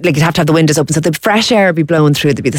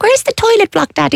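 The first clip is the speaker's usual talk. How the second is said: put-on child voice. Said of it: "We'd be like".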